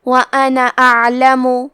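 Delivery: وَأَنَا أَعْلَمُ recited with the alif of أَنَا not stretched; short nun. Shown as a demonstration. Tajweed teacher demonstrating short alif, recited. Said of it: "حرف نون کو زبر سے پڑھ کے".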